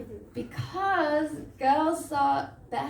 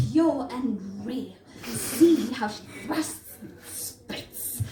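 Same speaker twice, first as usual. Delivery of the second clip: nature documentary presenter; 4 dB louder than usual. Acted. Date narrating a hockey fan like a wildlife programme, hushed-to-thrilled awe. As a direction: marvel at the beast like a TV naturalist. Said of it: "like in these nature programs".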